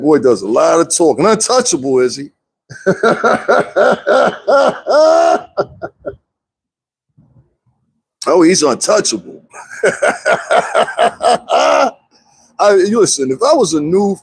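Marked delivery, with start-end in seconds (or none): 2.7-6.1 long, high-pitched cackle; drawn-out high laughing fit; mocking laugh. Real, not acted. Mocking someone called untouchable. 9.7-11.9 high, long burst of laughter; high derisive cackle; mocking laugh. Real, not acted. Mocking someone called untouchable.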